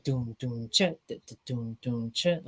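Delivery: slow, soft vocal drum pattern; half-time beat. Shown as a demonstration. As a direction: stretch out the dun-dun-chit beat at half speed. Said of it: "the chorus is in halftime".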